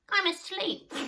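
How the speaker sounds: high, loud, cross yell from the window; cross shout. A narrator's reading. Sniff woken by his friends, shouting crossly. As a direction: shout it down crossly, still half asleep. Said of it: "shouted a cross voice".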